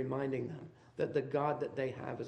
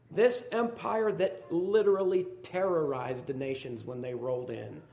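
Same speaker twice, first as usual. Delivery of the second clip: ordinary talk, nothing marked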